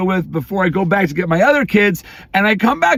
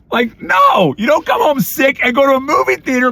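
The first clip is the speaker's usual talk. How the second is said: exasperated shout; 5 semitones higher than usual. Real, not acted. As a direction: yell it in disbelief. Dad ranting at sick son.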